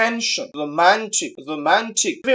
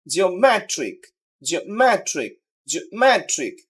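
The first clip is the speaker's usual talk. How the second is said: "Geometric" with stress on মে; stress before ending. Shown as a demonstration. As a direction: hit মে in "geometric", fall away on trick. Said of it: "শেষের আগের সিলেবলটাকে". ("জিওমেট্রিক"-এর মে-তে জোর)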